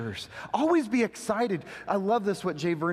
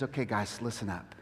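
lower, much softer intimate telling; soft hushed Jesus voice; quiet Jesus voice; 8 semitones lower, 7 dB quieter than usual. Retold or imitated. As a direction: lean in and speak gently to friends. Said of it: "And then he says".

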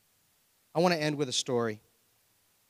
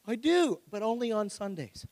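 sheepish excuse; 7 semitones higher than usual. Pretend. Believer's sheepish reply.

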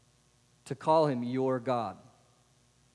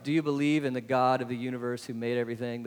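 ordinary talk, nothing marked

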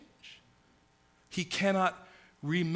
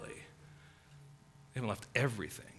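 hushed aside; 6 semitones lower, 11 dB quieter than usual. Acted. Hushed correction of hyperbole.

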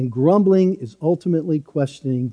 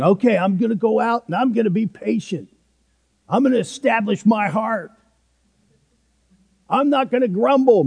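higher, quick, overconfident resolve, lightly hammed-up; mock self-reliance; 6 semitones higher, faster than usual. Preacher mocking the self-made resolution to stop grumbling, lightly hammed-up and cocky. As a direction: parody a guy sure he can fix himself. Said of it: "let's not be thinking".

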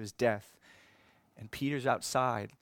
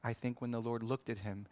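ordinary delivery

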